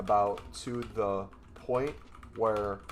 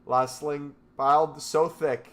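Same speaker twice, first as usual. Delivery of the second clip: excited; 4 semitones higher, 7 dB louder than usual. Real, not acted. Reacting excitedly to AI output.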